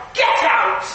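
stern command. Retold or imitated. Preacher ordering the dog from her room, stern. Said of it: "I use that tone as well".

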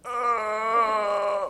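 a short cry of terror; mock shriek of fright; terrified shriek.

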